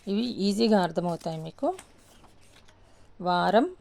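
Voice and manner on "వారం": ordinary talk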